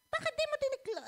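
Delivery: high, sharp imitation of a nagging wife; mock scolding wife. Husband mimicking his wife's scolding, high and sharp.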